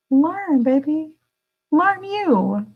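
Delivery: high, sing-song coaxing; sing-song encouragement. Pretend. Cheering the audience on to learn, high and sing-song.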